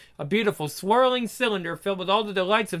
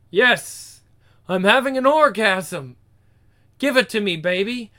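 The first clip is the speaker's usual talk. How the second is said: ordinary talk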